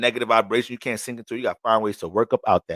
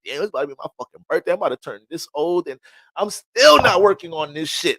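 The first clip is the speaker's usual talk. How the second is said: imitating frustration; 6 semitones higher, 6 dB louder than usual. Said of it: "frustrated, like".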